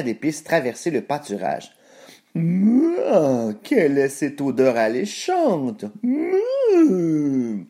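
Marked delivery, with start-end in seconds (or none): 2.3-7.6 mooing cow voice with drawn-out moos; wondering cow. A narrator's reading. Grazing cow sniffs a tempting smell, mooing. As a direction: moo high around the words. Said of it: "se demanda la vache".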